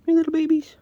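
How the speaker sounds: high, loud cooing call. Real, not acted. Farmer calling to kittens in loud high baby talk. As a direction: call sweetly to the babies.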